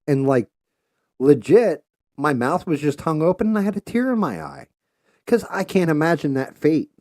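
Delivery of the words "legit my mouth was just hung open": normal talking voice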